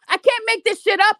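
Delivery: ordinary delivery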